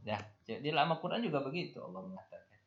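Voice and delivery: usual speaking voice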